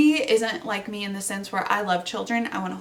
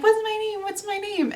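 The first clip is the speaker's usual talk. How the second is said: put-on high, sing-song little kid asking; mimicking a toddler's high chant; 12 semitones higher than usual. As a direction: squeak it like a three-year-old. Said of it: "for me, I'm like".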